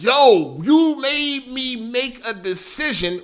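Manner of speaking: high, indignant confrontation; drawn-out, heated complaint; mad retelling. Retold or imitated. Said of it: "I was so fucking mad".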